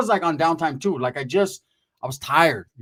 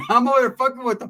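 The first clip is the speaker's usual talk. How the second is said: ordinary delivery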